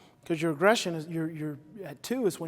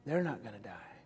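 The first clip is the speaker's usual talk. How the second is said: soft, lower reassurance; calming self-talk; 9 semitones lower, 6 dB quieter than usual. Shown as a demonstration. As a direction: murmur it to calm yourself. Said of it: "And then you say".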